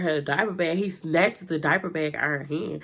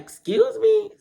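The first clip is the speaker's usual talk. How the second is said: high, loud outraged question; indignant disbelief; shocked; 5 dB louder, slower than usual. Retold or imitated. Accused of smelling marijuana.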